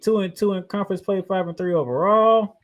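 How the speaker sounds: normal talking voice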